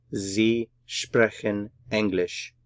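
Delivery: "Sie sprechen English" slowly, a gap after each word; word-by-word German "Sie sprechen English", falling at the end; slow model sentence. Shown as a demonstration. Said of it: "Just put it all together".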